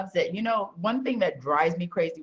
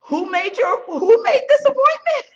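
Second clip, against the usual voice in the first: higher, louder and building, a near shout; indignant demand; 8 dB louder than usual. Retold or imitated. Telling Rudy how she confronts salesmen, high and loud. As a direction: blow up at the wasted meeting. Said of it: "and I'm like".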